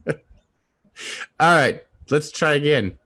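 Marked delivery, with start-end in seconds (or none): none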